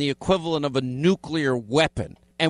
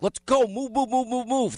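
high mock-urgent move move move; impatient hurry-up; 8 semitones higher than usual. Host urging a producer to hurry, mock yelling. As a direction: rush her along, half-joking. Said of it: "That's what I said that day".

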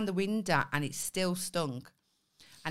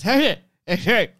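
put-on loud, slurred drunken hollering; drunk Irish man voice; 5 semitones higher, 14 dB louder, slower than usual. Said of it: "like an Irish drunken man".

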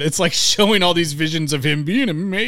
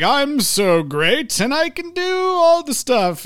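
boastful villain; 9 semitones higher, slower than usual. Retold or imitated. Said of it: "him being like".

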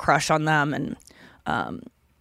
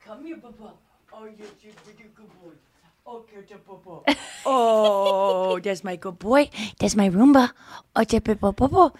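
cooing to pet; 2 semitones higher than usual. Real, not acted.

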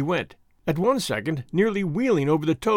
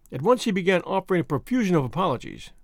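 plain reading voice

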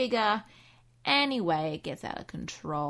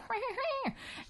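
high breathy witch cackle; 6 dB quieter than usual. Retold or imitated. Cat turns into a witch and cackles high. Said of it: "is all like".

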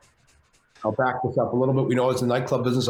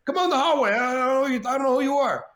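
much higher put-on De Niro voice; hammed-up De Niro calling out; De Niro impression; 14 semitones higher than usual. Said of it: "Robert De Niro goes by Bobby".

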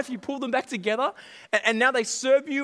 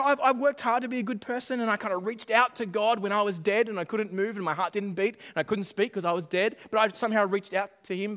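ordinary talk

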